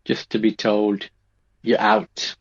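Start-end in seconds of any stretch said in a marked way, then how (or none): 1.6-2.4 a brisk, higher put-on boss voice; curt dismissal. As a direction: snap the verdict like an official. Being sacked on return.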